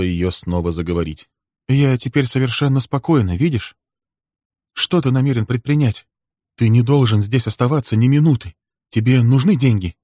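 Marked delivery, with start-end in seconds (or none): 1.7-3.8 higher-pitched woman's voice; urgent, high reassurance; anxious heroine. Acted. Heroine insists she is calm. 4.7-10.0 higher woman's voice, pressing him; worried, insistent questioning; urgent heroine. Acted. Heroine urges him to flee.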